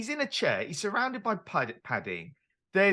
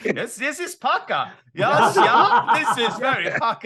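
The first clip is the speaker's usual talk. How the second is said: hammed-up German surgeon accent, higher, laughing through it; mock German doctor voice, cracking up; 5 semitones higher, 7 dB louder than usual. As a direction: play the scary German surgeon, half laughing. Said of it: "the scary German surgeons use the word pucker".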